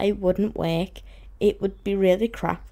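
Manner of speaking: ordinary talk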